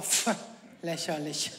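low soft scoff with a laugh; dismissive low chuckle. Retold or imitated. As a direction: brush it off as ridiculous. Mother scoffing.

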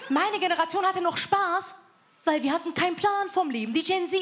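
ordinary talk

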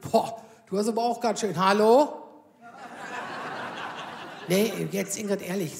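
lightly voiced doctor ribbing a patient; teasing doctor. Doctor teasing Ingrid about her weight, mock surprise.

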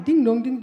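shorter ding dong chime; doorbell imitation. Comic showing the double ring again, doorbell chime.

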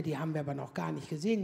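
husband brushing it off, low and quick; husband's voice. Retold or imitated. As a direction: rattle it off low and hushed like the husband. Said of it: "Sagt er".

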